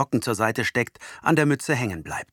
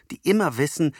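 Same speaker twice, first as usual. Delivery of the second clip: usual speaking voice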